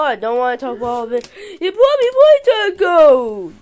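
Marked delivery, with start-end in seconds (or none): 1.6-3.5 high, loud, stretched-out cheer; mock excitement. Cutting short the doll's tape message, mock excitement.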